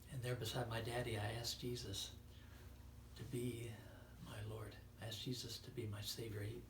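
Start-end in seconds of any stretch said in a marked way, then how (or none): 1.2-4.8 whispered and soft; hushed testimony. Acted. Preacher whispering his boyhood prayer. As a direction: draw them close with a whisper.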